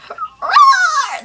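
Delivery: slow, loud, high mock roar; hammed-up loud dinosaur roar. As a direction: roar like a velociraptor, drawn out. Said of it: "like a dinosaur".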